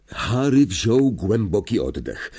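ordinary delivery, nothing marked

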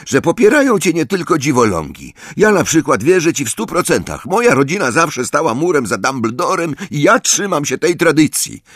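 pompous high-pitched proclamation; loud, carrying voice. A narrator's reading. Ernie pledging support to Harry, loud and pompous. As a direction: proclaim your support to the room. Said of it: "powiedział donośnym głosem".

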